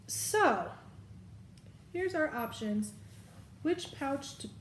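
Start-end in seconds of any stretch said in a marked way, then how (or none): 3.6-4.5 speaking through hiccups; words cut short by a hiccup. Real, not acted. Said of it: "I have the hiccups".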